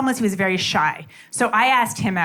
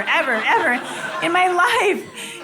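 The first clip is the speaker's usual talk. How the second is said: ordinary talk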